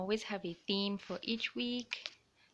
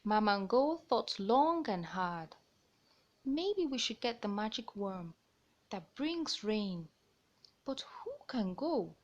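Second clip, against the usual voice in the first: lively picture-book narration; reading to children. A narrator's reading. Storyteller reading a bird tale to young listeners. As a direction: read it out like storytime.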